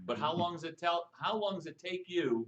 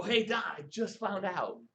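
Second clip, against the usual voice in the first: slow, slightly higher teasing line; teasing demo; 5 semitones higher than usual. Shown as a demonstration. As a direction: dangle it and stop.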